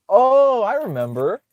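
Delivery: high, sarcastic mock realisation. Real, not acted. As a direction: drip with sarcasm. Mocking an opponent's claim.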